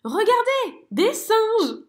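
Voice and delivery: cri très aigu et fort; exclamation ravie. A narrator's reading. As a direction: montrer les singes avec joie. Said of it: "s'exclame Zigomar". (merle ravi qui s'exclame)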